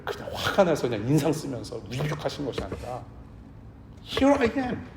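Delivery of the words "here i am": gentle Here I am. Pretend. Jesus appearing lovingly.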